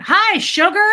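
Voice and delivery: bright mock fatherly hello; cheery dad greeting. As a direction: greet the baby like a beaming dad. Dad greeting newborn, acted quote.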